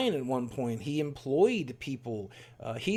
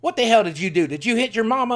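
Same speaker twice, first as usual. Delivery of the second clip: put-on louder, higher angry old man; grandfather's voice; 8 semitones higher, 8 dB louder than usual. Grandfather confronting his grandson on the phone, in an angry raised voice. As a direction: bark it like a furious grandfather. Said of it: "I'll do his voice".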